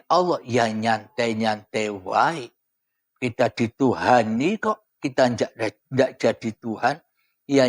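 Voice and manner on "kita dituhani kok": normal talking voice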